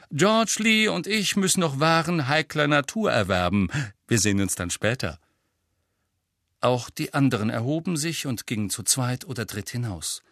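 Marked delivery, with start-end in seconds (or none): 0.1-5.2 munter. A narrator's reading. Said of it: "sagte Fred munter".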